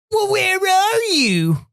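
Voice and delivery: high, drawn-out mock voice; changed voice. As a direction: mimic him in a stretched, high-pitched put-on voice. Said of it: "He changed his voice completely".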